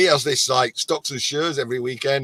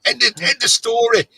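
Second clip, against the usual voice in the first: higher, emphatic mock refusal; retold firm refusal; 8 semitones higher, 4 dB louder, faster than usual. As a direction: re-enact shutting down a pushy caller. Retold refusal to phone seller.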